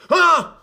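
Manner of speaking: sudden high, loud "ah"; mock scare yell. Reviewer interrupts himself to mimic a jump scare, sudden mock yell.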